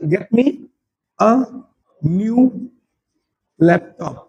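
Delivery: slow, with a pause between each word; teacher dictating word by word. Shown as a demonstration. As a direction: break it into single words for the class. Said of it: "मैंने क्या बोला?".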